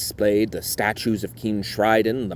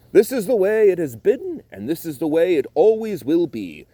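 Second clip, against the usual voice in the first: King Triton's voice; 9 semitones higher, 4 dB louder than usual. Acted.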